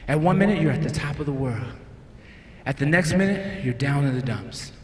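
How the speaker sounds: low, soft, measured delivery; solemn reflective message. Acted. Comedian sharing what God told him about relationships, solemn. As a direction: bring it down to a quiet, heartfelt lesson.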